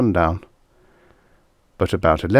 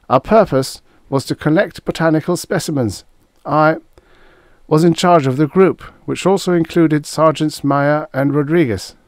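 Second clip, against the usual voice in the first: put-on high, louder young officer's voice, reporting; nervous young lieutenant; 8 semitones higher, 5 dB louder than usual. A narrator's reading. Frightened lieutenant giving testimony to his commander, nervous. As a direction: give a formal report in a high, uneasy voice.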